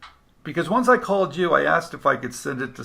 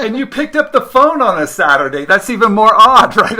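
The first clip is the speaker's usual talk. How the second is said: louder, high, laughing disbelief; amused disbelief; 7 semitones higher, 13 dB louder than usual. Real, not acted. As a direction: marvel out loud that they answered. Laughing amazement at Saturday pickup.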